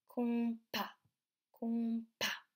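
"compas" stretched and split before the P; syllable by syllable. Shown as a demonstration. Teacher testing the P sound for children, split syllables. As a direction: say com, then pas, twice. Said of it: "Est-ce qu'on entend le son".

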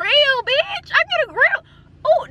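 high excited squeal about getting a grill. Real, not acted. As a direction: squeal it out with giddy delight.

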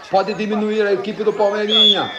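football commentary building to a goal shout; live play-by-play. Acted. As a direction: call the attack rising to GOL.

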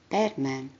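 usual speaking voice

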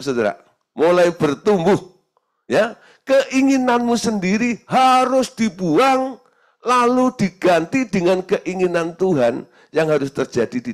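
loud, high, slow exhortation; preaching at full force. Acted. Preacher urges the congregation to grow, preaching loudly. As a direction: call them to grow.

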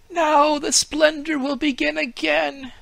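a naive tree marvelling aloud; tree's thoughts. A narrator's reading. Fir tree marvels at the Humpty Dumpty story, high and awed. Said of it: "thought the fir".

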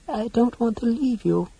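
high, small, tearful voice; brokenly. Acted. Creature cannot bear leaving her friend, brokenly. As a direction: plead in a little broken voice. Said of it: "she said brokenly".

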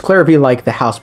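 usual speaking voice

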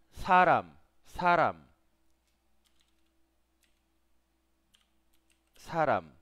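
Saram said loud, with a plain single s; teacher demo of Saram, slow and clear; Korean word saram. Shown as a demonstration. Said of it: "Ese es un solo consonante".

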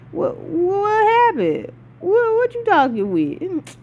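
high, slow, drawn-out exaggerated disbelief; mock bafflement. Fan replaying reaction to Mimir's second eye, mock bafflement. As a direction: squeal out the confusion, stretching each question.

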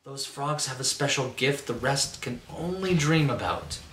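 usual speaking voice

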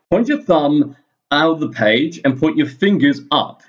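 usual speaking voice

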